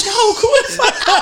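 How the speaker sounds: high, half-laughing plea; mock alarm. Retelling begging the wild friend to stop, in amused alarm. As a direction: plead high over the chaos, amused. Acts calling to calm the fight.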